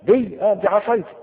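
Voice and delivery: high, loud, flustered stammer; voicing Moses caught off guard; startled Moses. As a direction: blurt it out in awe. Moses startled, answering God.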